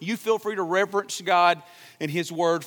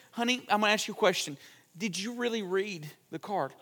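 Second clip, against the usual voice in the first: pointed quiet question; 8 dB quieter than usual. Retold or imitated. Wife's pointed question, retold.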